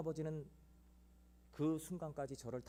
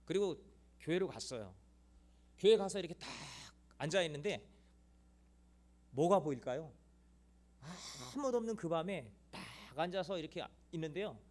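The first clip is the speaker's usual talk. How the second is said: ordinary delivery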